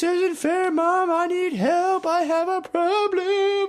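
high, slow whiny sob-voice; mock wailing. Teen crying in bed to his mom, hammed-up wail. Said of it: "crying down the hallway".

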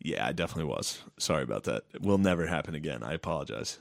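low, soft re-enacted admission; sheepish apology. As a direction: own up quietly and low, acting contrite. Acting out his eventual contrite apology.

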